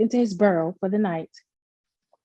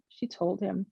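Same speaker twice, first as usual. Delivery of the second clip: ordinary delivery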